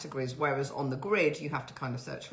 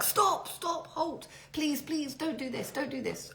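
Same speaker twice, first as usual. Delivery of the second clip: high-pitched mock panic; panicked pleading; 12 semitones higher, slower than usual. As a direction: beg him to stop, voice shooting way up. Acted plea to halt tree pruner.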